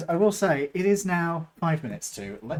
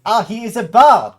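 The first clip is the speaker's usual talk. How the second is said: excited exclaim; 6 semitones higher, 15 dB louder than usual. Acted. GM's character exclaims.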